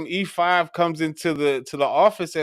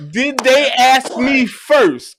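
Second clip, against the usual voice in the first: very slow, higher, louder mock demand; retold angry demand; 8 semitones higher, 6 dB louder, much slower than usual. Sergeant defending his soldiers, slow mock anger. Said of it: "He goes".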